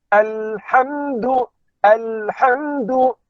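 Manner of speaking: alhamdu drawn out slowly with a clear, unbounced l; slow recitation. Shown as a demonstration. Teacher recites alhamdu slowly to students. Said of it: "Maka ha termasuk".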